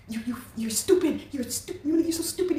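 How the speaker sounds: high-pitched sneering put-on voice; mocking the insulting man. Imitating her ex calling her stupid, high sneer.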